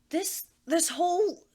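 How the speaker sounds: loud, high, slow sputtering; exasperated rant. Acted. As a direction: sputter with frustration. Anne waves arms wildly, ranting.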